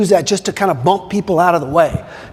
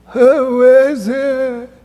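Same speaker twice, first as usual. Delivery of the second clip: put-on thin, very slow, higher old woman calling out; frail old voice; 8 semitones higher, 4 dB louder, much slower than usual. Old patient calling through the door, frail and slow. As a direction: quaver it from a sickbed. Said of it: "anything but robust".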